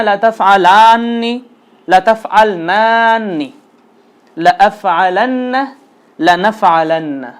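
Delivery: slow, deliberate chant of the forms; slow recitation drill. Shown as a demonstration. Teacher leading students in slow repeat-after-me drill. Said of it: "আমার সাথে সাথে অবশ্যই উচ্চারণ করতে হবে".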